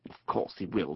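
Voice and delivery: low, soft, knowing chuckle; chuckling. A narrator's reading. Otter amused at Toad's rowing, chuckling. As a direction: say it quietly through a suppressed chuckle. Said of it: "chuckled the otter".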